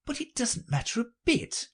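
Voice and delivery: put-on very high, light girl's voice; Alice thinking to herself. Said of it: "she thought to herself".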